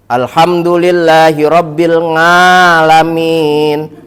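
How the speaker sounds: put-on Cilacap Javanese accent. Mimicking a Cilacap friend reciting, Javanese accent. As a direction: stretch "ngalamin" in a Cilacap accent. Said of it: "itu kalau ngomong ain, nga".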